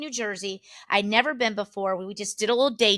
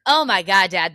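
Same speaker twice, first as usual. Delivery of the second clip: normal talking voice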